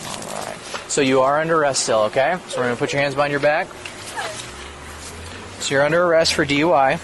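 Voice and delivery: body cam audio. Real, not acted. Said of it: "you're still being audio and video recorded".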